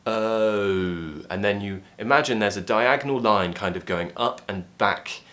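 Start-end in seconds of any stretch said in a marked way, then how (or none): 0.1-1.2 long "O" gliding from relaxed middle to rounded; British O diphthong. Shown as a demonstration. Teacher models the diphthong, long gliding British "O". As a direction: start "O" slightly open, then close it. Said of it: "tongue to start off just relaxed".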